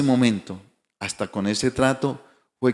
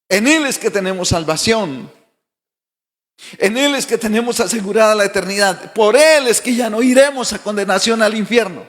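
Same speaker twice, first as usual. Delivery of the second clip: soaring, louder preacher's proclamation, higher pitch; preaching at full force; 8 semitones higher, 6 dB louder than usual. Acted. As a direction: proclaim each line like a fervent pastor. Opening praise proclamation.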